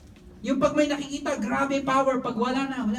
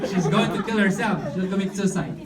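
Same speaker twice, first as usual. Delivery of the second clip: usual speaking voice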